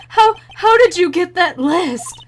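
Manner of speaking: loud, high, slow stammering shock; rattled. Acted. Cornered witness asks about the list, rattled. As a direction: stammer out the question in horror.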